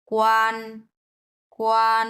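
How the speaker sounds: "কোয়ান" held level and loud; flat first tone. Shown as a demonstration. Teacher drills "কোয়ান" on a flat level tone for the learner. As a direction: keep "কোয়ান" completely flat, a steady pitch. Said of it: "একবারে flat ভাবে যাবে".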